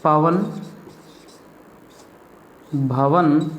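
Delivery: slow, spaced dictation of single words; teacher dictating Hindi words. Shown as a demonstration. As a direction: read each word out clearly for the class to copy. Teacher dictating word list.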